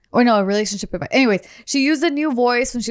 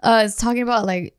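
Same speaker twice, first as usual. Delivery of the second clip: usual speaking voice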